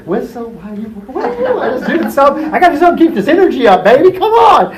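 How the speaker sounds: very high, loud, fast hype chatter; hyper excited. Acted. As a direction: pump up the crowd, laughing. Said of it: "the chaos the high I would create".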